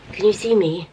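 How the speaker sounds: usual speaking voice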